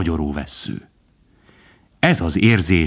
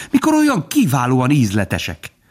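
higher, savouring woodpecker voice; with relish; 6 semitones higher than usual. A narrator's reading. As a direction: relish it like a delicacy. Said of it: "mondta a harkály élvezettel". (élvezettel, magasabb hangon)

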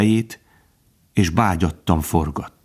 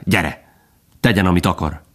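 plain reading voice

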